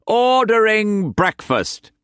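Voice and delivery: high, slow, grand announcement; Quance voice. A narrator's reading. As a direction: proclaim it like a lord.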